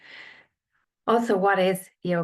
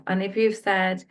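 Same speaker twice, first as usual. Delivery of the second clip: ordinary talk, nothing marked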